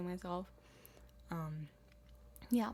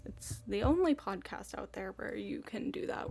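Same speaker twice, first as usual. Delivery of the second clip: usual speaking voice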